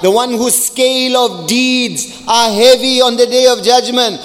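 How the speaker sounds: ordinary talk, nothing marked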